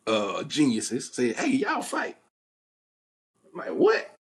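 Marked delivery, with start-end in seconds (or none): 1.4-2.2 egging-on cousin. Retold or imitated. Older cousins egging on a fight. 3.7-4.2 high, loud disbelieving what; shocked what. Retold or imitated. A boy told to fight reacts in shocked disbelief.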